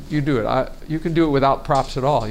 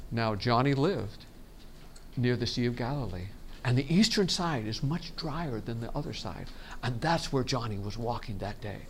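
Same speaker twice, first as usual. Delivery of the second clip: quiet narrator's aside; narrator stepping in; 8 dB quieter than usual. Shown as a demonstration. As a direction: drop out of the action to give details. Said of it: "That's the narrator stepping in to tell you details".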